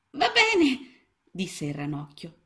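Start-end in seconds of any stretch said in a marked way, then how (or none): 0.1-1.1 put-on very high, small child-frog voice; a tiny lost frog, unsure; little frog. A narrator's reading. Lost little frog answering Mrs Balzelli, high and small.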